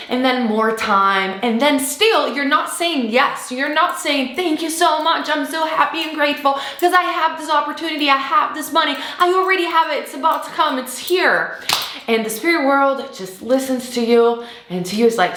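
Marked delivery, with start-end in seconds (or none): 4.3-11.6 higher and fast, joyful thanks; grateful excitement. Pretend. You thanking the spirit world, higher and fast with joy. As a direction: rush out happy thanks.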